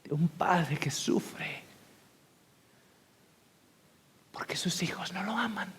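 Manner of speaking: slow unvoiced whisper. Real, not acted. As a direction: let it fall to a grieving breath. Sermon, sorrowful point. (susurro lento y triste)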